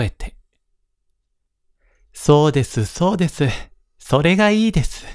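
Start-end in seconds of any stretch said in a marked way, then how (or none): 2.1-5.0 high, eager wife chiming in; eager young wife. Acted. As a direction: chime in brightly, higher and quicker. (高い声で熱心に賛成する嫁)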